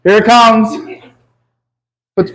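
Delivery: ordinary talk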